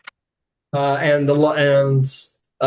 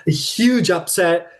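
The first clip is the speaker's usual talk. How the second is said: ordinary delivery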